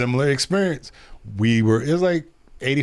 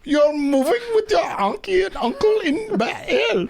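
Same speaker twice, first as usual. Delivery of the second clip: high, hammed-up mock German rap; badly faked German accent, pitched way up; terrible German accent; 12 semitones higher than usual. Scared mom sends the kid away, terrible German accent. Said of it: "I got a terrible German accent".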